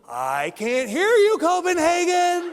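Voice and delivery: loud, high, drawn-out crowd call; hype-man call. Retold or imitated. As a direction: rile up a silent crowd like a motivational speaker. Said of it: "he would do one of these moves".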